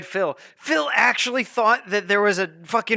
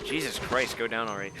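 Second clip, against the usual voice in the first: muttered; 8 semitones lower, 9 dB quieter than usual. Real, not acted. Muttering at the game.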